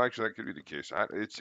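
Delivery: ordinary talk, nothing marked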